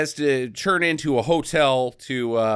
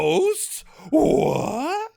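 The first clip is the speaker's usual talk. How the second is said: much higher, a yelp of disbelief; shocked squeal. Retold or imitated. Peter O'Toole hearing his castle has ghosts, high shocked yelp. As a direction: squeak it out as if the news is absurd.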